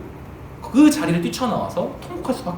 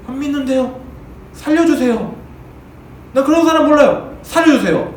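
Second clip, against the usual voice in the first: scared pleading; 7 semitones higher, 4 dB louder than usual. Pretend. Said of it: "당장에 무서워서".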